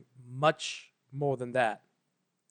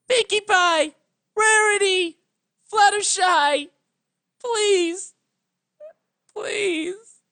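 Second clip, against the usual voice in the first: very high, very loud tearful calls; anguished shouting; 9 dB louder, slower than usual. Acted. Twilight calling her lost friends across the sea, sobbing and shouting. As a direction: shout the names through tears. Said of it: "continued to shout, anguished tears".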